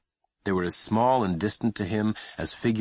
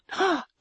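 shocked gasp. Acted. Mother shocked.

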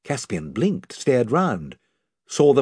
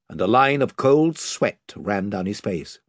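ordinary delivery, nothing marked

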